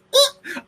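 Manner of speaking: sharp very high shocked gasp; dramatic gasp. Retold or imitated. Acting out her shock at seeing him cuddling, high gasp. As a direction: gasp like a meme zoom-in. Imitating the zoom-in sound effect.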